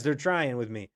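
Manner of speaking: ordinary delivery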